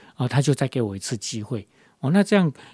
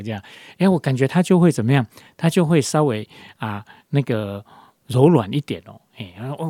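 ordinary delivery

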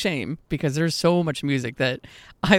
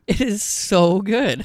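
slow, higher, emphatic gush; gushing emphasis; 6 semitones higher, 4 dB louder, much slower than usual. Real, not acted. Cohost gushing about an album that rocked, emphatic delight. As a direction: savor each word with delight.

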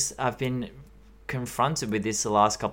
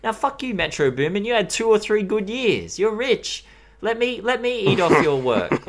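mock arrogant thief; 8 semitones higher than usual. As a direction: gloat at the producer like a shameless thief. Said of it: "and be like".

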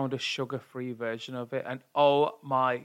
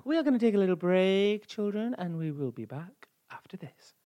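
mock radio host announcement; presenter voice; 6 semitones higher than usual. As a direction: announce the break like a show host. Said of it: "host-style break announcement".

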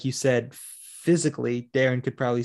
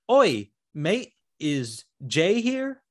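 higher, drawn-out Australian impression; mock Australian accent; 4 semitones higher, much slower than usual. Girlfriend asking for Jay, hammed-up Australian accent. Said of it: "I know, a beautiful Australian accent".

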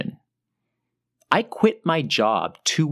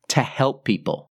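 ordinary talk

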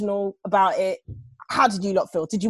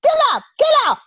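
high Jamaican calling out; Jamaican accent; 6 dB louder than usual. Retold or imitated. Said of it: "[name] was like".

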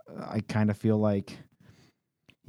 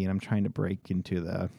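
normal talking voice

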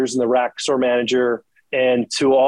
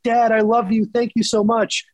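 put-on higher, sweet kid's thank-you; imitating a happy kid; 8 semitones higher than usual. A kid thanks his dad for a gift, lightly voiced. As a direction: voice a child thrilled by a gift.

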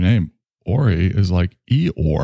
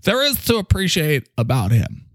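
high pompous boss voice; 7 semitones higher than usual. Acted. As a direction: play a self-important leader delivering news.

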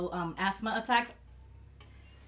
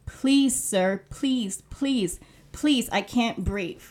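emotional reenactment of someone begging to breathe; reading a desperate plea; 2 semitones higher, 7 dB louder, slower than usual. Retold or imitated. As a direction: give his last words as a desperate plea for air. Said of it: "These were his last words".

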